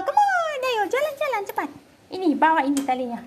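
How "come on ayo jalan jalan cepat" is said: high, fast, eager come on; eager high push to go for a walk; high excited urging. Real, not acted. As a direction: hurry the dog along, high and quick.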